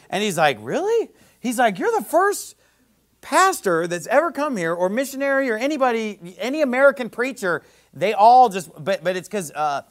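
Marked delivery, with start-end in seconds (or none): 0.6-1.1 high astonished question; a big high "really?"; mock amazed host; surprised really. Host amazed at shower story. 1.8-7.6 amazed host. Retold or imitated.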